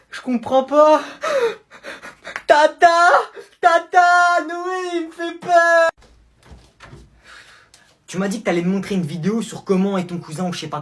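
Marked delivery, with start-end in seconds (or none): 0.1-5.9 high, loud panicked cries for auntie; hammed-up scared shouting to Tata; terrified yelling. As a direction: scream for help in fake terror. Screaming for the aunt.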